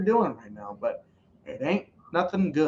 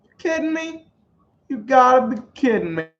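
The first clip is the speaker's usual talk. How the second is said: high, then louder "you gotta be kidding me"; can't believe what you just saw; disbelief; 8 semitones higher, 5 dB louder than usual. Real, not acted. Reacting to a bad play.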